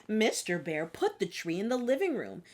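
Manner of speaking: plain reading voice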